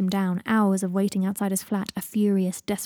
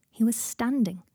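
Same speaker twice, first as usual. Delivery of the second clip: usual speaking voice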